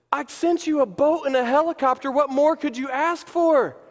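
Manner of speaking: high-pitched incredulous reply; acted exasperation; exasperated comeback. Acted Jesus answering complaint.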